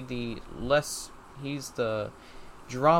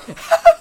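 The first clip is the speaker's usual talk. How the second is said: burst of laughter; 17 dB louder than usual. Real, not acted. Friend doing podcast in shower.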